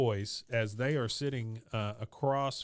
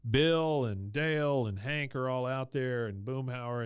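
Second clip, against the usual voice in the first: ordinary talk, nothing marked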